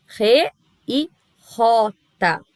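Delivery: "Re e rota" com J raspado do fundo da garganta, devagar; som de J espanhol. Shown as a demonstration. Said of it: "vem lá do fundo da nossa garganta". (J espanhol gutural e lento)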